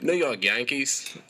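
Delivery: put-on slow Aussie accent; drawn-out Australian accent. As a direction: say it slowly in his Aussie voice. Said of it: "That was really good".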